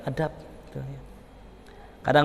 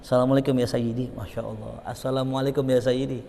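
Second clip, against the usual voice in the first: reverent greeting; 3 semitones lower, 7 dB quieter than usual. Retold or imitated. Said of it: "langsung nelfon langsung gini".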